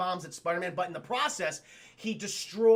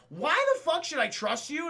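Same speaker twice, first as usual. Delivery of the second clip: higher-pitched, mildly indignant mock demand; lightly acted suspicious comeback; distrustful challenge; 8 semitones higher, 3 dB louder than usual. Spider-Man to Norman who offers a secret way in, mock-indignant.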